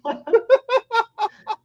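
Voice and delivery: squeaky high laugh; high giggle. Real, not acted. Laughing at the slip.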